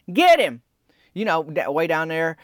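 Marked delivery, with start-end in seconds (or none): 0.1-0.6 high, loud holler from far below. Retold or imitated. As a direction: call it up from the parking lot. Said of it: "Cheryl Grubbs hollers".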